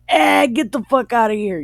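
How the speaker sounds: loud, high, quick mock yell; acted angry kick-out; retold harsh dismissal. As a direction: throw them out, loud and fast. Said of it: "treated her really bad and was like".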